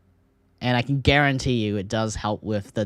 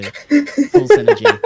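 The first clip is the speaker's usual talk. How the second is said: slow, much higher and louder "Full synergy"; mock hype; 10 dB louder, much slower than usual. Caster hamming up Korean team teamwork, slow and much higher and louder. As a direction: stretch it out like a hyped caster.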